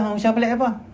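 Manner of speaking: high-pitched annoyed challenge; irritated retort. Acted. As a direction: act out someone touchy taking offence. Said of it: "dia kata". (lakonan orang cepat marah)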